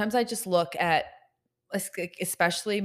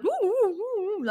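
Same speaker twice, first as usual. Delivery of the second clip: put-on high sing-song baby talk; 14 semitones higher, faster than usual. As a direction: coo at a baby, pitched way up. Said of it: "people are like".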